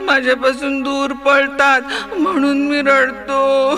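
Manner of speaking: put-on a sulky child complaining through tears; lightly acted sniffly whine; whimpering child. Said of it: "तो मुळूमुळू रडू लागला".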